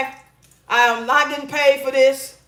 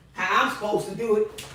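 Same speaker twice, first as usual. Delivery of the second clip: usual speaking voice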